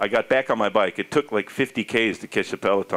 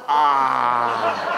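long, held "ah" of relief; relieved sigh. Retold or imitated. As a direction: let out a blissful sound of release. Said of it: "it was such a relief".

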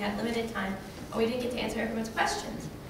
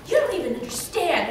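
higher-pitched, exasperated imitation; mock angsty; 6 semitones higher, 4 dB louder than usual. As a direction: imitate someone getting worked up in your face. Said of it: "kind of getting, like, angsty".

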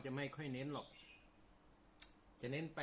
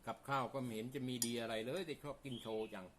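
talking while chewing; words round a bite of food; mouth full; 3 dB quieter than usual. Real, not acted. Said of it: "ชอบกินโชว์จังเลย".

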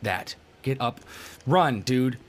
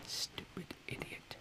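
very soft, slow whisper; whispered insult; 17 dB quieter than usual. Real, not acted. Gamer cursing the character going wrong way, slow whisper. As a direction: whisper it slowly under the breath.

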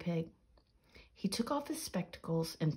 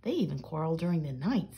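ordinary delivery

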